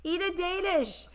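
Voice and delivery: mock-pushing a pastry on her; pushy baker friend. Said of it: "She's like".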